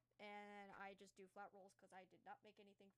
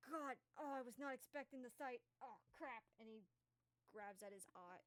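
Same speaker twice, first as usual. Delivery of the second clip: usual speaking voice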